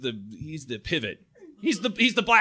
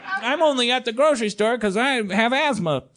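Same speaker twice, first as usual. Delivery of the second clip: high nasal-free nerd voice, raised pitch; higher, meek nerd excuse; nerdy kid voice; 9 semitones higher, 3 dB louder than usual. Retold or imitated. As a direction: play the nervous asthmatic kid. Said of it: "he's like".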